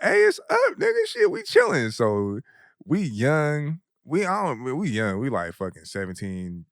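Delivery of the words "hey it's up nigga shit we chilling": high excited hype. Retold or imitated. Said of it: "So I was like".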